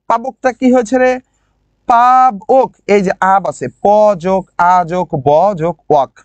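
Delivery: ordinary talk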